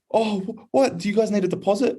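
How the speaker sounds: put-on high excited girlish voice; a thirteen-year-old girl gushing. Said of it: "She goes".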